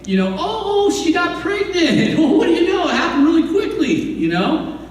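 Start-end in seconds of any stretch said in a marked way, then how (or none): 0.4-3.9 high-pitched, put-on astonishment; mock surprise. King covering up the pregnancy, mock surprise. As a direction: act amazed, stretching it out then hurrying along.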